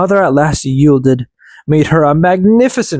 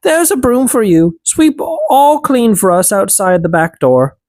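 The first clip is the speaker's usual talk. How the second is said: elves instructing; 7 semitones higher than usual. A narrator's reading. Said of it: "they said".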